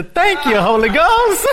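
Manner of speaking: high, loud cry of thanks; joyful call-out. Shown as a demonstration. Preacher calling out his own updated catchphrase. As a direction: call it out with delight. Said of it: "I have updated that to".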